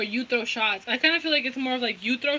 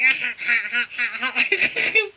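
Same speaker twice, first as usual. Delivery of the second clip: put-on "ha-ha" cackle; mocking laugh imitation. Teasing viewers about their laugh, mock cackle. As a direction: imitate someone's odd laugh, drawn out. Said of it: "talking shit about your laugh".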